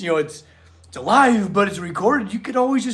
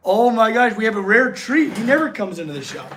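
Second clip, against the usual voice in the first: usual speaking voice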